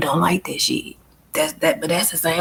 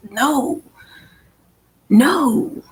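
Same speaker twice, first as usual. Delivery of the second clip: firm high no; 6 semitones higher than usual. Real, not acted. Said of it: "firm refusal".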